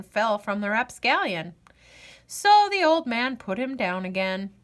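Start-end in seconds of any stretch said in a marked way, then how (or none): none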